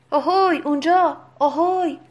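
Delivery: loud, high hail; راشله shouting. A narrator's reading. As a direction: holler to someone far away. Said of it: "راشله فریاد زد". (صدا زدن با فریاد بلند)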